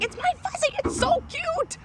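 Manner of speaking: very high-pitched child squeal, imitated; squeaky mock-excited kid; excited little girl. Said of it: "she's all excited".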